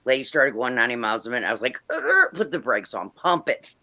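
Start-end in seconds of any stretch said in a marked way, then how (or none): 1.9-3.7 raised-pitch halt; mock slamming on the brakes; retold interruption. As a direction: cut off a runaway talker. Halting a fast talker, retold.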